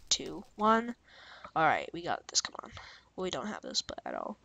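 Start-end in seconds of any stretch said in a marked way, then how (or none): 1.6-2.7 hushed whisper of self-encouragement. Real, not acted. Bracing for the battle. 3.2-4.4 hushed whisper of self-encouragement. Real, not acted. Losing the battle.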